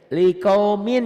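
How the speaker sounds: likaumin with the written N sounded, high and loud; loud teacher's demo of likaumin, N kept; ends in a clear N. Shown as a demonstration. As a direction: say LIKAUMIN with the final N pronounced, "min". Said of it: "ini kan min".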